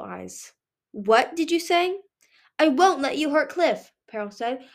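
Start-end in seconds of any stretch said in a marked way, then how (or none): 0.9-2.0 menacing challenge. A narrator's reading. 2.6-3.9 defiant. A narrator's reading. Peril defies the queen.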